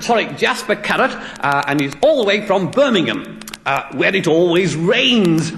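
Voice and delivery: high-pitched mock presenter; radio DJ patter, stretching out the closing word; on-air radio DJ. DJ correcting his guest's name on air, mock presenter voice. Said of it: "going on to the air live now".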